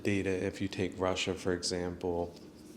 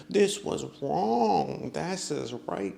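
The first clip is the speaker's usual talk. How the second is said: high, slow, preachy mock voice; mock moralizer; 7 semitones higher, slower than usual. Mocking atheists who make moralistic arguments, high and preachy. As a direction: send up a sanctimonious scold. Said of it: "kind of arguments".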